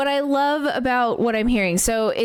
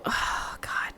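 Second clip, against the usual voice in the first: a real whisper, much softer; awed whisper; 10 dB quieter than usual. Real, not acted. Viewer overwhelmed by the song, whispering. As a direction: breathe it out in awe.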